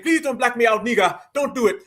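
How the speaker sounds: pleading in a put-on African accent; mock African-accented plea. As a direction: beg in an imitation African accent. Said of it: "the African saying".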